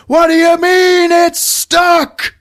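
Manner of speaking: high, loud, slow outraged screech; mock furious screech. Wang strangling the girl, a high outraged screech. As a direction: screech it in cartoon fury.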